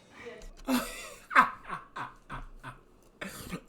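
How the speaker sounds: high, helpless cackle; loud burst of laughter. Real, not acted. Laughing at the clip.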